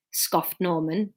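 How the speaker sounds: plain reading voice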